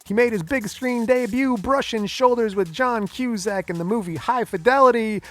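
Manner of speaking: high, loud podcast intro; big ring-announcer style introduction; hyped show announcer. Acted. Hosts a hyped-up guest introduction.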